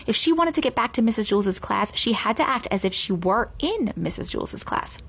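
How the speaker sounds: plain reading voice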